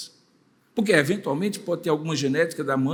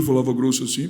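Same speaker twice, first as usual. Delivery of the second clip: deep voice; 8 semitones lower than usual. Retold or imitated. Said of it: "porque o pai falava grosso".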